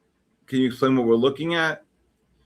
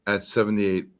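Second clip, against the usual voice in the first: ordinary talk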